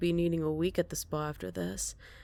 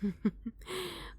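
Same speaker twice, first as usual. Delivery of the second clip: quiet, breathy chuckle; soft chuckle; 4 semitones higher, 7 dB quieter than usual. Acted. Captive chuckles at the officer.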